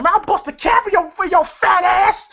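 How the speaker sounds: high-pitched, hammed-up threat; mock angry threat. Pinky threatening Elroy back, in a mock angry high voice.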